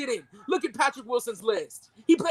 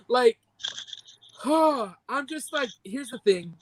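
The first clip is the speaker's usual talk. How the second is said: ordinary delivery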